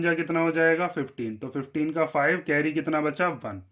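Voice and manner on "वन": ordinary delivery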